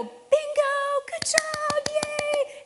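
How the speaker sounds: very high, loud cheering; cheery praise. Retold or imitated. Mother cheering her tumbled daughter, high and loud. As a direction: cheer the kid on brightly.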